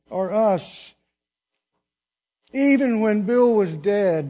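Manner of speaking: loud, rising, slow preacher's build; full-force preaching. Acted. Preacher reading the verse to the church, full force. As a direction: drive the verse home to the congregation.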